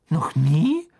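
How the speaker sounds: a mother amazed he never used Floo powder; surprised mother. A narrator's reading. Mrs Weasley surprised.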